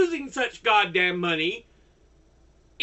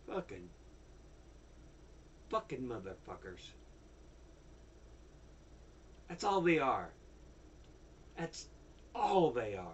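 much softer, lower muttered curses; quiet seething; 9 semitones lower, 16 dB quieter than usual. Real, not acted. Spent ranter muttering curses at the group. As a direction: grumble it under your breath.

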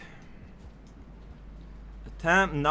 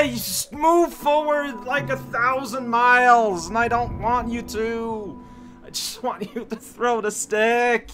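annoyed scolding; 6 semitones higher than usual. Real, not acted. Scolding game character.

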